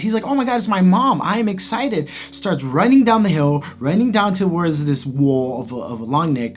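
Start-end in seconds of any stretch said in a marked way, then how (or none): 0.2-2.1 put-on high-pitched, gleeful young dinosaur; gleeful excitement. Littlefoot thinking he found his mom, gleeful. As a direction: imitate a child's glee, voice pitched up. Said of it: "he has glee in his eyes".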